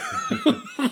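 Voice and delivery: loud high laugh. Real, not acted. Burst of laughter.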